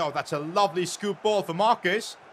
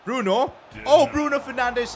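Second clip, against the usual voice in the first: rising, louder yell at a chance; excited shout; 5 semitones higher, 6 dB louder than usual. Real, not acted. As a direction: cry out as the shot goes in. Live goal chance in the match.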